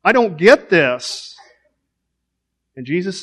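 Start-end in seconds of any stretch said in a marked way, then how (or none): 0.0-1.4 louder, higher, pleading confusion; exasperated prayer, much louder and higher; frustrated cry to God. Pretend. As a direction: cry out to God, bewildered, slowing at the end. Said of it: "and it's like".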